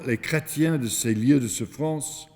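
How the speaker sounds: ordinary talk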